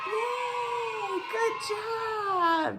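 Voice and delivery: high, bright-voiced cheer for a little learner; big classroom praise; excited cheer. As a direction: cheer them on like they won a prize. Teacher cheering a correct answer.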